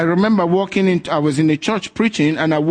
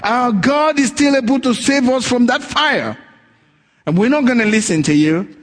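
raised, defiant retelling of the three youths; 7 semitones higher than usual. Retold or imitated. The three youths defying the king, raised and defiant. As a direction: throw it back at the king, unafraid.

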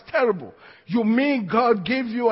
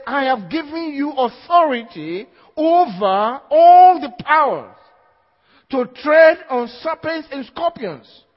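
slow, loud, high scripture proclamation; preaching; 6 semitones higher, 8 dB louder than usual. Acted. Preacher declaring Jesus's words to the congregation. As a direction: proclaim the verse with force.

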